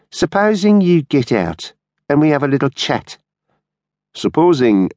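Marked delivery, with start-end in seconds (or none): 0.1-3.2 high-pitched, wheedling menace; taunting blackmailer. A narrator's reading. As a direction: press him mockingly for a chat. Blackmailer pressing the driver to talk.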